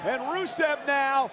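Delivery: excited high play-by-play shout; wrestling commentator. Real, not acted. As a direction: call the big move, thrilled.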